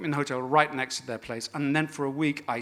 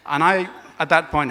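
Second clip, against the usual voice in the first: usual speaking voice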